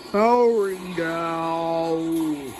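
slow robotic shutdown voice. Acted. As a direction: drone it like a machine.

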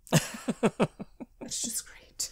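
slow awed whisper; breathy whisper. Real, not acted. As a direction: breathe it out in awe. Gushing over the casting.

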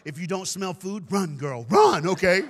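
loud shout of run; urgent warning. Real, not acted. Warning a little girl in the audience, shouting. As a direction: yell it at her like a warning.

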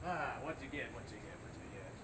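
soft cooing. Acted. Soft cooing at vending machine.

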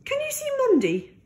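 high, fast and eager; eager high question. Acted. Handa asking Grandma about the hen, high and eager. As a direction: ask quickly, voice way up.

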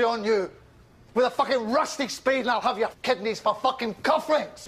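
high, emphatic command; angry threat. Acted. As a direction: order them off with menace. Threatening someone into a corner.

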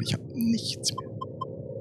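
breathy whisper, no voice. Shown as a demonstration. (flüsternd)

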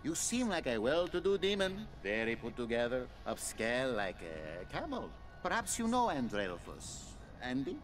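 put-on Italian accent. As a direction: gush like a charming Italian flatterer. Player voices Italian demon Roberto.

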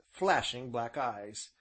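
ordinary delivery, nothing marked

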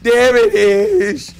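excited coaxing. Real, not acted. Streamer coaxing butterflies onto his finger, excited high pleading. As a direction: beg the butterflies to land, eager.

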